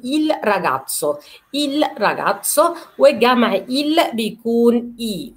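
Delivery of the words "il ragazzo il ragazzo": teacher models il ragazzo slowly; model phrase. Shown as a demonstration. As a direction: draw out il ragazzo for learners. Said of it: "فبقول".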